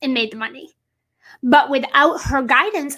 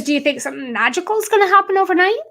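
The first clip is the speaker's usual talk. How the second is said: higher, faster pointed coach voice; imitating her coach; 6 semitones higher, faster than usual. Coach challenging Ann, a higher, quicker pointed voice. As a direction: lightly voice the coach pressing her. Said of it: "She goes".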